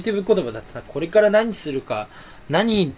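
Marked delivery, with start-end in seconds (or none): none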